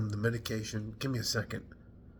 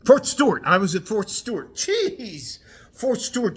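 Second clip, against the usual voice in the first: much higher and louder, the name finally found; relieved recall; 10 semitones higher, 8 dB louder than usual. Real, not acted. Veteran finally recalling his old base, excited. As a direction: blurt it out as it comes back.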